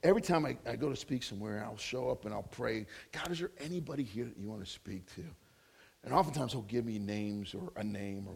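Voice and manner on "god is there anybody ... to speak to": low, soft, quick private prayer; acting out praying under your breath; retold quiet prayer. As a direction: murmur a question up to God. Retells his own private prayer.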